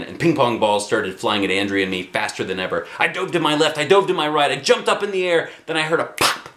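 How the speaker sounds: ordinary delivery, nothing marked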